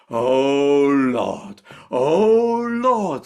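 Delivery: the delighted wife cries out to heaven; overjoyed. Acted. Wife hearing the goose news, joyful loud cry.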